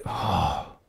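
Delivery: humbled, hushed reply to God; sheepish admission. Retold or imitated. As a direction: concede quietly, caught out. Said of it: "And I was like".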